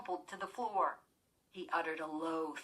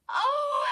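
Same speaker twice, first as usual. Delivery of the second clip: high, loud startled gasp; girl gasping loudly at the sudden light; 10 dB louder than usual. A narrator's reading. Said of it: "Chris gasped loudly".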